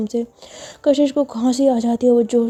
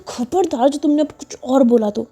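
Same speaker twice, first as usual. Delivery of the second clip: a hammed-up angry rant; angry threat; 4 semitones higher than usual. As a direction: snap at the nagging child. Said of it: "गुस्से में".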